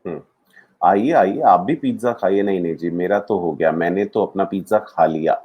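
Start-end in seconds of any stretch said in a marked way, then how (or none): none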